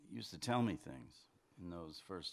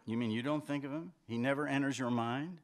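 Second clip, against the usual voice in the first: raised, loud disbelieving questions; can't believe what you're hearing; incredulous challenge; 7 semitones higher, 7 dB louder than usual. Acted. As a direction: press the question, higher and louder. Acted argument, disbelieving challenge.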